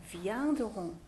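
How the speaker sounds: "vient de Rouen" slow and soft, as vyan-duh-rong; pronunciation demonstration. Shown as a demonstration. Teacher repeats "vient de Rouen" for students, slow pronunciation demonstration. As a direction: sound out "vient de Rouen" slowly, ending on nasal "rong". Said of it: "어떻게 발음하나요?".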